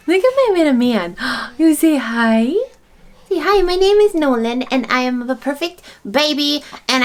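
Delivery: playful sing-song voice for her son. Real, not acted. Mom talking to her baby.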